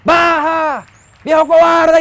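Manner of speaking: high, loud angry shout at the neighbor; yelling from the balcony at a man beating a woman, angry; retold angry yell. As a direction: bellow it across the street like you're furious. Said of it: "a pegarle gritos al tipo".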